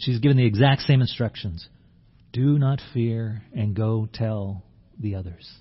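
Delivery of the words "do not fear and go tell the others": slow tender. Pretend. Jesus instructing Mary.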